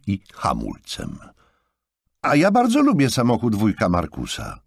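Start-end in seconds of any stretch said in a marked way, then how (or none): 2.2-4.6 put-on high, loud, bouncing child's cry; enthusiastic exclamation. A narrator's reading. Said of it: "wykrzyknął z entuzjazmem Charlie". (entuzjastyczny okrzyk dziecka)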